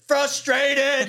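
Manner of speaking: squeaky, high mock frustrated. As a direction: whine the word in mock frustration. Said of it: "say the line in a frustrated way".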